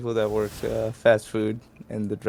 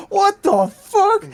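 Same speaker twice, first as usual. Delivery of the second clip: very high, loud, drawn-out mock shriek; outraged yell; 7 dB louder, much slower than usual. Pranked worker yelling over the drive-thru mic, drawn-out outraged shriek. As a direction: stretch it into a piercing, incredulous yell.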